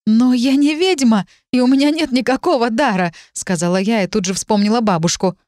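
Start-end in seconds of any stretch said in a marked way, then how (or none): 0.1-3.1 high, distressed denial; insistent, rattled objection; upset protest. A narrator's reading. Woman protests she is no witch.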